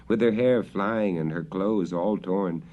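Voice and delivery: ordinary delivery, nothing marked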